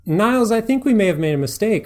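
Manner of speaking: high, loud, anxious excuse-making; flustered Fraser. Acted. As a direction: fake a polite panic. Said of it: "Fraser addresses the others".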